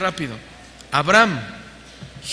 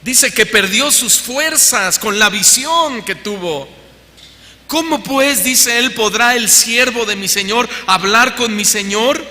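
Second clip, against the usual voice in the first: high, loud, forceful sermon delivery; fiery preaching; 6 semitones higher, 6 dB louder than usual. Acted. Pastor reading Daniel to congregation with fervour.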